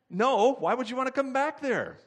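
preacher's big incredulous punchline. Real, not acted. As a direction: throw the question at the crowd.

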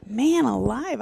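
ordinary delivery

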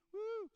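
high, loud mock whoop of joy; ecstatic whoop. Ribbon lady dancing in the aisles, loud high whoop. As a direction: let out a wild celebratory woo.

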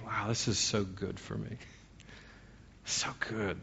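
hushed, private murmur; quietly accepting a humbling moment; humble self-talk. Acted. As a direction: say it softly, as if to yourself and God. Acting out humbled private prayer.

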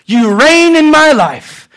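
high, slow proclaimed prayer; grand, drawn-out declaration; fervent prayer. Acted. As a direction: cry it out to God with conviction. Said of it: "we pray in our life and we say".

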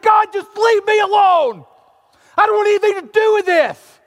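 loud, high angry refusal; shouted plea to be left alone; defiant shout. Retold or imitated. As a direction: yell it back at God. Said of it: "and I said".

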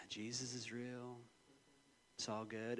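savoring a zen moment; blissed-out calm. Retold or imitated. Father at the window with coffee, soft and serene. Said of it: "and I'm like".

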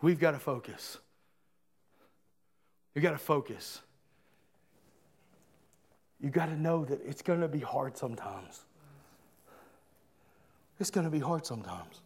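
low, soft, subdued delivery; hushed preaching. Real, not acted. Preacher backing off a touchy point to urge focus, hushed. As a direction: drop right down and let each thought settle. Preacher backs off, turns solemn.

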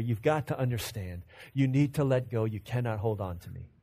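soft, low and tender; hushed, tender farewell; gentle Jesus voice. Acted. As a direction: plead with her gently to let go.

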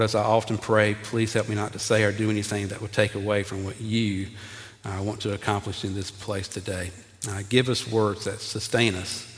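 low, soft, measured public prayer. Real, not acted. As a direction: pray humbly over the congregation. Leads the congregation in prayer.

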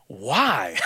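low, drawn-out mock why with a laugh; incredulous why. Pastor imitating the governor's disbelief, low scoffing why. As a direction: drop low and scoff the word. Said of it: "Governor's like".

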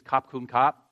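"kap kun kap", Thai style, the final "kap" lifting up; Thai thank-you. Shown as a demonstration. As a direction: say "kap kun kap" in Thai with aspirated k sounds and a rise on the last "kap". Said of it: "thank you in Thai is".

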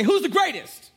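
put-on high, loud rallying call to the kids; mock hype call-out. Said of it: "when I say".

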